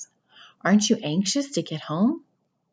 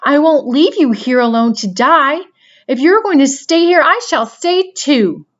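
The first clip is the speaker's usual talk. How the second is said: shouting; 8 semitones higher, 10 dB louder than usual. A narrator's reading. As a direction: cry it out in anguish. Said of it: "shouted Wilbur".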